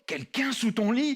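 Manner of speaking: usual speaking voice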